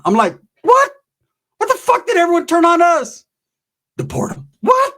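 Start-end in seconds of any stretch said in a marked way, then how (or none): 0.6-3.2 very high, loud disbelieving yelp; shocked squeal. Retold or imitated. Narrator stunned by the dealers, very high yelp. 4.0-4.5 unvoiced whisper; whispered aside. Muttered aside. 4.6-4.9 very high loud what; shocked squeal. Retold or imitated. Narrator stunned again, very high yelp.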